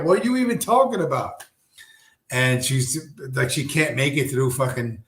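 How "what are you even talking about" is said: high-pitched, whiny bafflement; hammed-up high confusion; mock exasperated trainee. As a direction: play her totally lost and complaining. Retold baffled trainee complaining.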